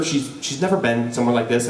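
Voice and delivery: ordinary delivery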